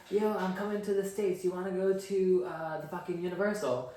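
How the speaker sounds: eager friend. Retold or imitated. Visiting friend asks speaker to Universal, eagerly imitated.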